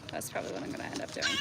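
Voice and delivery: whispering to herself; whispered aside. Real, not acted. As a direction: breathe it out as an unvoiced aside.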